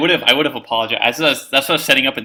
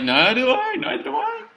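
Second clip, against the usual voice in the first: very high comic falsetto; bewildered squeal; 13 semitones higher than usual. Acted. Gen answering Darcy's confusion, high squeaky mock wail. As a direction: squeak it out, pitch climbing sharply.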